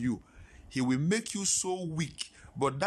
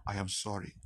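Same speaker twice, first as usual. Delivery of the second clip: low, soft, subdued apology; retold tearful apology; 7 semitones lower, 6 dB quieter than usual. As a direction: retell a crying apology quietly, without tears. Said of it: "I was crying saying it".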